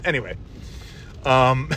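usual speaking voice

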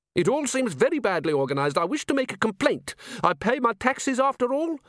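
prim, outraged curator; squeaky fussy curator. Acted. Curator complaining.

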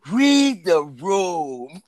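hugely elongated words; drawn-out proclamation. Pretend. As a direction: stretch the rule out as far as possible. Repeats the rule after laughing fit.

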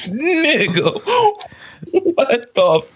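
high, amused outrage; half-laughing what-the-hell; laughing shock. Retold or imitated. His shocked, laughing reaction.

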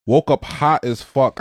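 normal talking voice

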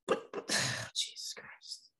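whispered sigh of despair; exasperated whisper. Real, not acted. Host despairs over the choked playoff series, whispered. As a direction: breathe it out, fed up and drained.

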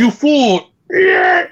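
high, loud hammed-up shout; mock villain shout. Friend acting out a movie scream, hammed-up and loud. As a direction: yell it like a cartoon villain.

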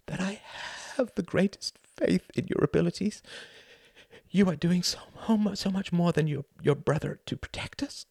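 high, halting, emotional mother; thin high voice, faltering between phrases; Ruth, tinny voice. A narrator's reading. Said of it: "said Ruth's tinny voice".

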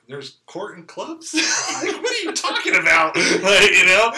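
incredulous, laughing through the words; laughing disbelief. Retold or imitated. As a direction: laugh at how absurd it sounds. Retold disbelief at court news.

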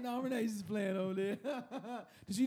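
high giggling words; laughing. Real, not acted. Laughing retelling.

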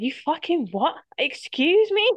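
high, loud, hammed-up indignant snap; mock outraged retort. Holding back a mock furious comeback to the stranger. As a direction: play it as mock fury at the stranger. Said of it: "I had to stop myself saying".

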